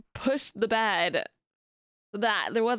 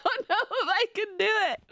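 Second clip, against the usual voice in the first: laughing; 7 semitones higher than usual. Real, not acted. Laughing at attempting witch laugh.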